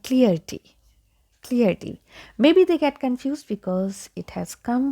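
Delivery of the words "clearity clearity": clarity as CLEAR-tee; mispronunciation. Retold or imitated. Teacher imitates how people get it wrong, mispronounced clarity. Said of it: "I have seen people pronounce it like".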